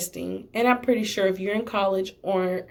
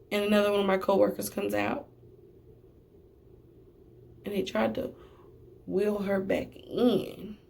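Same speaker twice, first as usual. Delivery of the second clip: ordinary delivery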